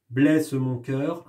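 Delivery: slow, each syllable spaced; rhythmic reading. Shown as a demonstration. Teacher demonstrating rhythm to pupils, slow and spaced. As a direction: stretch blesse mon cœur out to the beat. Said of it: "le rythme du poème".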